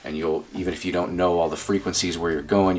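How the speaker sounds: ordinary delivery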